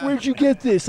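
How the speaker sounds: high, loud furious demand; mock furious dad yelling. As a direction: shout it like an enraged father. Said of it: "And he goes".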